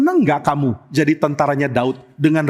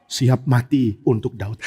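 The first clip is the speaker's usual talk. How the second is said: tearful vow; 6 semitones lower, slower than usual. Acted. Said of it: "kenapa masih nangis".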